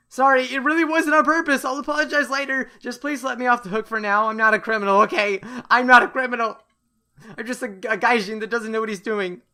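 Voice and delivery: high, loud desperate excuses; frantic pleading. Acted. Fleeing foreigner to the angry girl, frantic pleading. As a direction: beg to be let off while fleeing. Acted pleading while running away.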